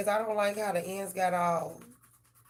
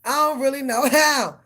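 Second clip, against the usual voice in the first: a hint of a whiny, helpless cat, louder; voicing the cat; 6 semitones higher, 9 dB louder than usual. Cat answering owner from the tree, lightly voiced whine. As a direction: whine back like a stuck pet. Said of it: "He was looking like".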